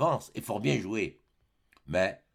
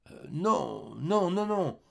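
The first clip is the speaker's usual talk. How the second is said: higher, halting refusal; flustered denial; 7 semitones higher, much slower than usual. A narrator's reading. Cousin refusing to show the letter, flustered. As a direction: stumble into a hasty no.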